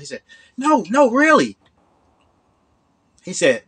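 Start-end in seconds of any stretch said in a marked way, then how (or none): 0.6-1.6 much higher, eager insisting; don't let him say no; mock-earnest hustler; pushy insisting. Scammer insisting.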